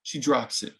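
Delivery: usual speaking voice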